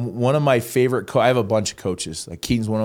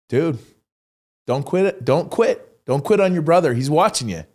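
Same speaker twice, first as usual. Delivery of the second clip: self pep talk; 5 semitones higher than usual. Retold or imitated.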